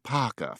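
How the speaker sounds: "paka" with both Rs dropped. Shown as a demonstration. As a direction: say "paka" for Parker, no R sounds. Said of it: "written but not pronounced".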